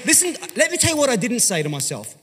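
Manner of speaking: ordinary talk, nothing marked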